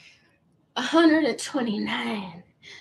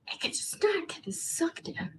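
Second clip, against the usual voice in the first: whisper; 5 semitones higher, 12 dB quieter than usual. Real, not acted. Strained whisper in corset.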